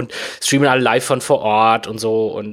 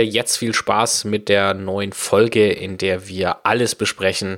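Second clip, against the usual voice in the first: announcer read; 3 semitones lower than usual. Acted. Slow low intro read over music.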